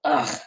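low, throaty "ugh" of disgust; acted yuck noise; disgusted splutter. A narrator's reading. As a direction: splutter out a low groan of revulsion. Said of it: "she spluttered".

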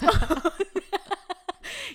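high, loud burst of laughter; real big laugh. Real, not acted. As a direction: crack up.

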